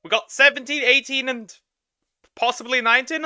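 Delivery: high, loud celebration; real elated whoop of victory; excited cheer. Real, not acted. Celebrating finishing the episode.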